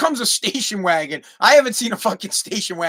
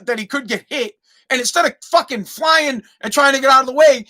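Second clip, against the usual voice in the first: usual speaking voice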